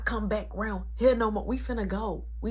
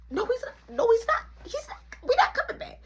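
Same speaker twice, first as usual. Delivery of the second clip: mock-frantic, very high-pitched; panicked refusal; 3 dB louder, faster than usual. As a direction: shoot way up high, squeaky protest. Escalating refusal in retold scene.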